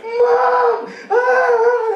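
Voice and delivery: very high screaming cry for mom; panicked yell. Retold or imitated. Friend screaming for mom over the dead arm, high cry. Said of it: "Most of them react like".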